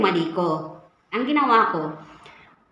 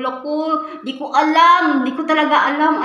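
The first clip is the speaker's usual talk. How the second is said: higher and louder, slowed flustered denial; flustered protest; 6 semitones higher, 7 dB louder than usual. Retold or imitated. Caregiver caught recording, protesting loudly to fellow Pinay caregiver. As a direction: blurt it out, caught red-handed.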